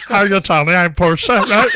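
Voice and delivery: very loud, high impeded-voice greeting; imitated mimicry. Portia answering the stage manager, loud imitated impediment. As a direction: belt out a copy of the other person's impediment. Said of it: "she's talking like that with him".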